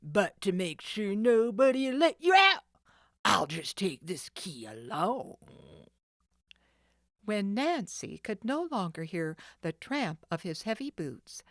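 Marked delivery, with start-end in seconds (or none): 0.0-5.4 loud gruff taunt; taunting villain. Acted. Sid taunts locked-up Nancy, loud gruff. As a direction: rub it in.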